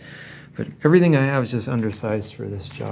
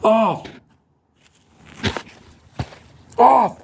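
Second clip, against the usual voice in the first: shouting; 11 semitones higher than usual. Real, not acted. Yelling at camera to stop.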